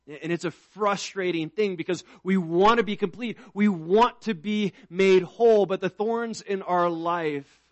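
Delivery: preacher promising God takes all thorns; preaching at full force. Acted. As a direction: build the promise to a ringing finish. Preaching builds to climax.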